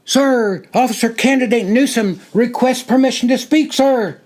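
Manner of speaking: high, loud formal scream. A narrator's reading. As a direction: scream the request at attention. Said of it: "screamed into the side of his red".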